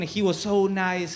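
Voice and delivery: normal talking voice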